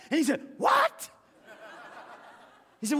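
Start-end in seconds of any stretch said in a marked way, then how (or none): 0.6-1.1 very high, loud squeak; the pastor hearing about the blank check; shocked what. Retold or imitated. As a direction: shriek it in disbelief.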